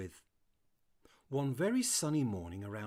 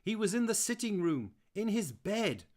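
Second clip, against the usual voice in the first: high, loud woman's voice calling down; wife shouting back; 11 semitones higher, 9 dB louder than usual. Acted. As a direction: call down the stairs as the wife, loud. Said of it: "I could hear Sally shout back".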